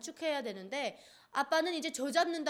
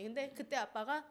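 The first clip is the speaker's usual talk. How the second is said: ordinary delivery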